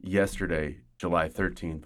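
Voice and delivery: normal talking voice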